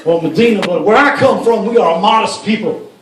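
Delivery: indignant politician in a hammed-up Arabic accent; speechify in a put-on Arabic accent; mock Arabic accent. Lawmaker debating women's modesty, mock Arabic accent. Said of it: "That's my Arabic accent".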